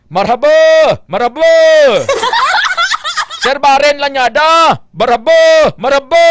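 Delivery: loud "marhaba"; Arabic greeting. Shown as a demonstration. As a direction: call out "marhaba". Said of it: "sa Saudi Arabia".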